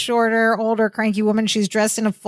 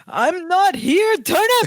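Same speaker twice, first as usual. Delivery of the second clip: I'm not here, Turnip shouted high and slow; a high, strained cry of panic; panicked gasp-shout; 8 semitones higher, slower than usual. Retold or imitated. Twinkasetti panics at the phone, high slow shout. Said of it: "he clutches his chest".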